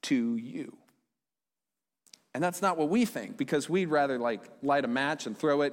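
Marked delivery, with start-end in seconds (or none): 0.0-0.8 gentle promise. Retold or imitated. Preacher voices Jesus gently promising heaven to people. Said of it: "He says".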